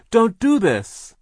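high, loud, slow plea; crying out. A narrator's reading. Sister begs brother to stop, crying out. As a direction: beg with a drawn-out cry. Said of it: "Violet cried".

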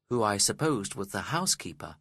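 usual speaking voice

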